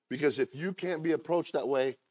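ordinary delivery

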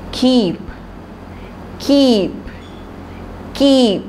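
put-on slow word-by-word reading for children, each word said thrice; slow reading drill. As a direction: read it out slowly so the children can repeat. Teacher drills a reading lesson. (धीमे-धीमे बच्चों को पढ़ाने वाली आवाज़)

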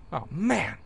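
ordinary delivery

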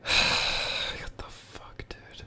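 imagined reader's hushed disbelief; stunned whisper. Acted. Instagram staff reading his tweet, whispered disbelief. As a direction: whisper it on a slow sigh.

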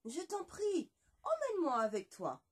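high begging voice; pleading animal. Acted. Le loup supplie la moitié de poulet, voix suppliante. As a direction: plead to be taken along. Wolf pleads to come along.

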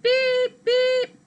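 squeaky high beep beep. Acted. Teacher reading to kids, high beeping sound. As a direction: chirp the beeps up high like an alarm.

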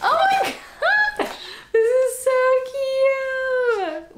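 high loud squeal of delight; squeal, stretching it slow. Real, not acted. Opening a gift for her dog, high slow squeal.

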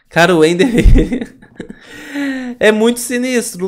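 high, loud, through laughter; laughing while talking. Real, not acted. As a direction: laugh the words out. Laughing about the fight.

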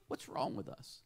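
low, soft troubled question; quiet despair. Retold or imitated. Augustine about to cry before his friend, quiet despair. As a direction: sink into a quiet, defeated question.

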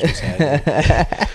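slow, loud, high greeting; drawn-out loud what's happening; loud playful call-out. Acted. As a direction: hype it up like a host. Calling out to vocal coaches.

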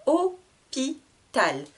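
"Hôpital" very slow, Hô... pi... tal; split into syllables. Shown as a demonstration. Teacher checking for the sound i, "Hôpital" in three slow beats. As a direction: pause after each syllable of "Hôpital". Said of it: "je coupe le mot en trois syllabes".

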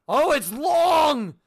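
gasping delight at the long thing; excited. Real, not acted. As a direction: stretch it out in amazement. Amazed at long item.

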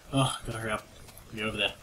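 normal talking voice

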